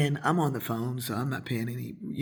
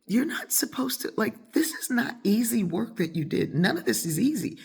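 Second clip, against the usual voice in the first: high, emphatic insistence; imitated astonishment; 8 semitones higher than usual. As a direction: insist like an amazed friend. Friends insist her work is astonishing.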